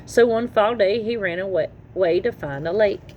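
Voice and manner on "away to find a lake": ordinary delivery, nothing marked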